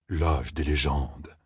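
low, very slow, musing; ponderous Loyal. A narrator's reading. Companion pondering the captain's revelation, low and ponderous. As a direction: murmur it slowly in a deep register.

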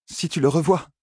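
high little voice in the head; mild inner cry, pitched high. A narrator's reading. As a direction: nag from inside her head in a small high voice. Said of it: "cria dans la tête d'Elaine une petite voix".

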